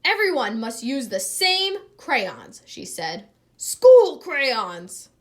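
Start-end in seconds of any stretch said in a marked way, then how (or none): 0.1-2.6 louder, higher stern teacher voice. A narrator's reading. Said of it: "Miss Landers was not pleased". 3.6-5.1 loud high sharp teacher cry; stern exclamation. A narrator's reading.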